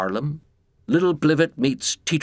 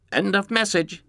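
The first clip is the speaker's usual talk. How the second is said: higher robotic answering machine voice; flat recorded machine announcement; 9 semitones higher than usual. Acted. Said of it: "said the robot voice".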